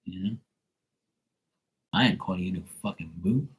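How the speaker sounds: ordinary talk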